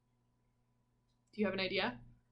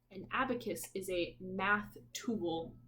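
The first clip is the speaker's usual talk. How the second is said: ordinary delivery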